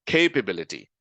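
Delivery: KAY-pability, heavy stress on the first syllable; common mispronunciation. Shown as a demonstration. Teacher showing learners' mistake, "capability" mispronounced with stressed KAY. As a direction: punch KAY at the start of "capability". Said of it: "heard a lot of people pronounce this as".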